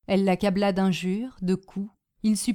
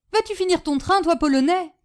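loud, higher shout through the door; 9 semitones higher, 5 dB louder than usual. Acted. Aunt yelling at Boginski pounding the door, loud and high. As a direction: bark at the Pole knocking outside. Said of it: "cria-t-elle à Boginski".

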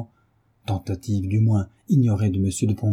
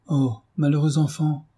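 a frightened cry, pitched higher; alarmed cry; 6 semitones higher than usual. A narrator's reading. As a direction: cry out in dismay at the son's danger. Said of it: "s'écria Marie". (s'écrie, effrayée, plus aigu)

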